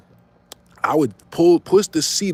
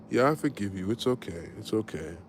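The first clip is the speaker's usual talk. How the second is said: fake soothing; 4 semitones lower, 9 dB quieter than usual. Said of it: "I acted like I didn't care".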